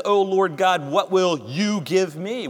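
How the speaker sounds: the weight falls on "you", not "what"; stress on you. Shown as a demonstration. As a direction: lean on "you" in "what will you give me". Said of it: "What's the emphasis there?".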